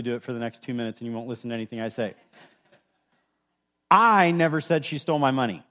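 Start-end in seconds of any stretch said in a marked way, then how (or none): 3.9-5.6 "I" stretched and hit loud; stress on I. Shown as a demonstration. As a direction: punch the word "I", then let the rest fall away. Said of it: "emphasize a different word every time".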